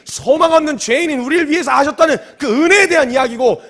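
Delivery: loud, high-pitched angry harangue; retold furious tirade. Preacher scolding believers for their attitude in worship, furious tirade. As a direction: lose your temper and demand an answer. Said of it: "승질을 내고 막 화를 냈어요".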